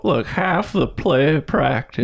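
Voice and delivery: creaky grandpa voice, slowed down; old man voice. Shown as a demonstration. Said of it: "trying out my old man voice".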